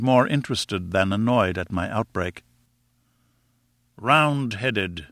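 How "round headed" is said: ordinary delivery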